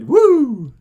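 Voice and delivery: loud, high whoop; excited whoop. Real, not acted. Streamer whooping to chat in celebration. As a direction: cheer it out.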